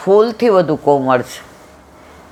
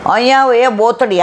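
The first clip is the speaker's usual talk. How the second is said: calling out; 7 semitones higher than usual. Retold or imitated. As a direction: call them over urgently. Calling the family over.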